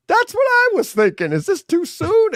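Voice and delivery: a high, hammed-up squeak of doubt; mock high voice. Parent retelling own question to kids, in a high mock voice.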